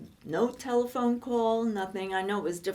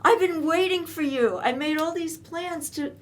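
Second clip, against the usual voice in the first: higher and louder, fed-up complaint; upset girlfriend; 7 semitones higher, 5 dB louder than usual. Retold or imitated. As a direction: snap back at the boyfriend's excuses. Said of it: "And I'm going".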